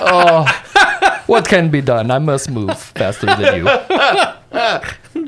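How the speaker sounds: hammed-up lament called out to a crowd; mock shouting. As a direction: cry out theatrically while pushing through. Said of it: "He starts shouting to the crowd".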